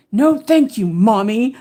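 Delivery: high, loud yell of "No, thank you, Mommy"; yelled politeness. Retold or imitated. Son yelling at mom, furious but polite. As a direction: shout the polite words like a tantrum. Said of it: "yell at me and go".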